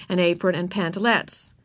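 ordinary delivery

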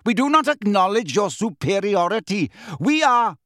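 high, forceful declaring; indignant tirade. A narrator's reading. Centaur of ancient people rejecting wizards, indignant.

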